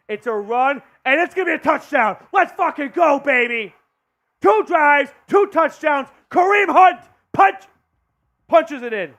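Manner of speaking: yelling the touchdown call; loud, rising touchdown roar; excited shouting. Real, not acted. Calling a touchdown run.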